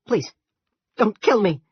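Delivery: begging for his life at full voice; terrified scream. A narrator's reading. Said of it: "Randolph screamed".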